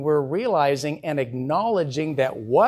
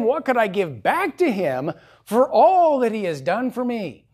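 higher, louder impassioned preaching; preaching at full force; 7 semitones higher, 4 dB louder than usual. Acted. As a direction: build the sermon to a fervent peak. Preaching climax.

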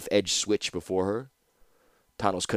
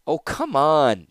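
higher, drawn-out teasing coax; smiling; 7 semitones higher, 4 dB louder, slower than usual. Acted. Captor dismisses the prisoner's protest, teasing with a smile. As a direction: say it slowly through a smile. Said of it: "said Ashnod with a smile".

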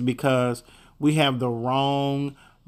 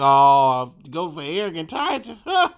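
voicing God; 4 dB louder than usual. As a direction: play God scoffing at a meager offering. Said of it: "looked at us and be like".

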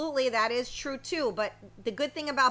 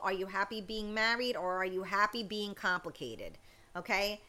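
ordinary talk

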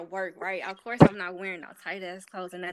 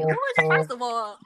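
louder, indignant comeback; fired-up retort, retold; 5 semitones higher, 8 dB louder than usual. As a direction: climb higher and louder as you challenge him. Acting out her angry retort.

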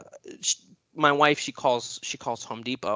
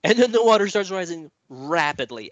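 higher and louder, pressing the story forward; building urgency; 5 semitones higher, 5 dB louder than usual. Acted. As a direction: ramp it up as the water climbs. Builds the flood moment.

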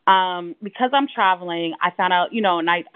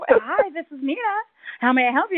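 work voice; 7 semitones higher than usual. Retold or imitated. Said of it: "this is my professional voice".

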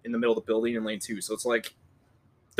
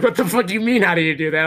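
mock outrage; 3 dB louder than usual. Retold outburst at confused customer.

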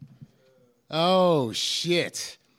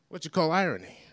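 usual speaking voice

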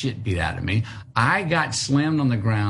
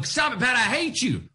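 retold yell; 10 semitones higher than usual. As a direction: imitate a child's outburst without full volume. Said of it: "and I yell out".

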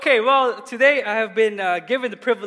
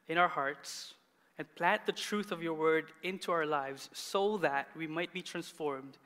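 soft, lower praying voice; prayer; 4 semitones lower, 8 dB quieter than usual. Real, not acted. Pastor opening the word in prayer, soft and reverent. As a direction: pray quietly, pausing between phrases.

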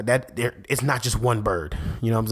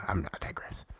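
hushed, low, near-whispered aside; quiet aside; 6 semitones lower, 11 dB quieter, faster than usual. Real, not acted. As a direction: drop the tangent under your breath. Drops a joking tangent.

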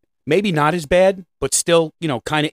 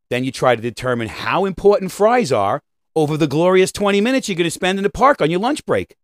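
normal talking voice

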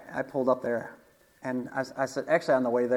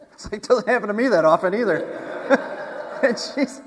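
talking through real laughter, higher and louder; laughing; 6 semitones higher, 5 dB louder than usual. Real, not acted. Telling the congregation his own surprise, laughing through it. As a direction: let the laugh break through the words.

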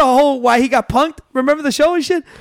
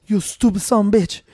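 unruffled prankster manager; 2 semitones lower than usual. Retold or imitated. Prankster needling an angry wrestler, unruffled insult. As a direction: insult delivered evenly, without raising the voice. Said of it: "pretending to be the manager".

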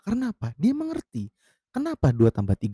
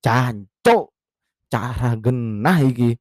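annoyed Javanese curse; 3 dB louder, much slower than usual. Retold or imitated. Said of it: "udah kesel gua bilang".

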